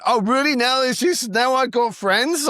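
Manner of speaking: high-pitched mock outrage; indignant retort. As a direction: argue back in a high, put-upon voice. Retorting to jeering rednecks.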